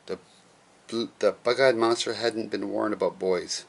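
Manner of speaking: plain reading voice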